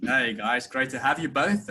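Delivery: ordinary delivery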